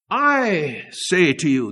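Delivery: "I" drawn out slowly and emphatically; stress on I. Retold or imitated. Preacher quoting Jesus to the congregation, long stressed "I". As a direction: lean long on "I", then fall away.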